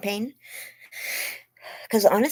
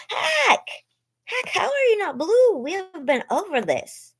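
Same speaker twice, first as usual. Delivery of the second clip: much higher playful hello; excited greeting. Real, not acted. Streamer playfully greeting a viewer joining in. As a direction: tease a regular viewer with delight.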